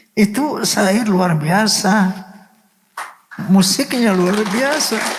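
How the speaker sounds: slow, high, overcome voice; emotional awe. Real, not acted. Songwriter moved by his own song, awed and emotional. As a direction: marvel slowly, pitch lifted with emotion.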